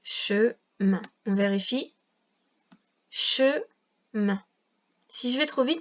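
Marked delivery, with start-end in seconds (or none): 0.0-1.0 "Chemin" split, che, pause, min; two separate beats on "Chemin"; syllable-by-syllable dictation. Shown as a demonstration. Relit le mot dicté. 3.1-4.4 "Chemin" slowly split, che, pause, min; long gap inside "Chemin"; syllable-by-syllable dictation. Shown as a demonstration. Vérifie le mot par syllabes.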